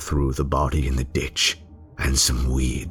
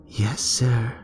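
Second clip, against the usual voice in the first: put-on small child, higher; 8 semitones higher than usual. Child answers voiced.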